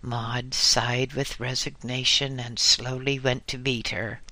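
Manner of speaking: ordinary delivery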